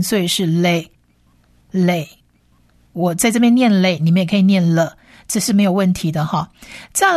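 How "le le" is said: le said slowly as "lay", twice with a pause; le pronounced "lay". Shown as a demonstration. Teacher blends lamed with its vowel, slow "lay". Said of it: "是发呃或者是a的音".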